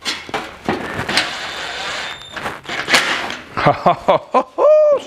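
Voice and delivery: breathy unvoiced laugh at his own pun; wheezy silent laugh. Real, not acted. Laughing at his own joke, a wheezy silent laugh.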